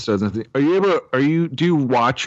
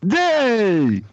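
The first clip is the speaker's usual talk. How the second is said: silly voice; 9 semitones higher than usual. Pretend. Trying the silly voice.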